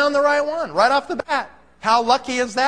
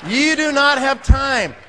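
emphatic shout; 2 semitones higher, 4 dB louder, slower than usual. Real, not acted. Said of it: "shout heard".

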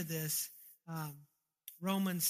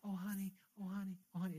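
softly cooed mock call to a child; gentle mock parent; 8 dB quieter, faster than usual. Parent feebly calling a kid running to the street, soft mock coaxing. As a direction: call out far too softly, as if nothing is urgent. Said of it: "you're not gonna go".

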